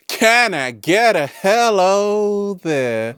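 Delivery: high, slow drawn-out call; hammed-up hello call; catchphrase call. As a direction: stretch it out like a showman's catchphrase. Catchphrase called out to audience.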